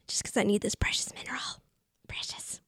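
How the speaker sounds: hushed, whispered craving; whispered greedy quote. Acted. Mimics a creature's craving for precious.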